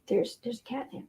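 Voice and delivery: soft, hushed, voiced murmur; hushed pet talk. Real, not acted. Owner murmurs softly, showing the cat catnip.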